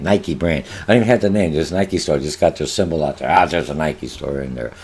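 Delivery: usual speaking voice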